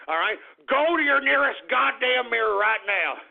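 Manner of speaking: ordinary talk, nothing marked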